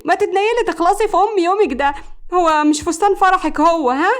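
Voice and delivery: high-pitched fed-up scolding; exasperated. A narrator's reading. Friend scolding the bride-to-be's dawdling over a dress, exasperated. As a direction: hurry her up in exasperation. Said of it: "ردت عليها بغيظ".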